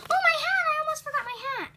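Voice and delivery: high, fast alarmed recall; sudden panic. Acted. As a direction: gasp it out in a rush. Suddenly remembers her hat.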